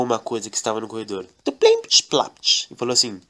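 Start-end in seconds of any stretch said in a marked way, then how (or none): 1.4-2.7 high squeaky thud and clatter noises; playful sound effect of a kick. Acted. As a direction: mouth out the bump and clatter. Kicking something in the corridor.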